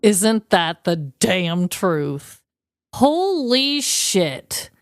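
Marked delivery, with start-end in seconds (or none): none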